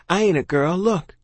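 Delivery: high-pitched insistence; urgent child. A narrator's reading. Child proving herself to soldier, high and urgent. Child shows potato as proof.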